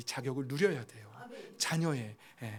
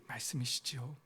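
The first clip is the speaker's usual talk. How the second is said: ordinary talk, nothing marked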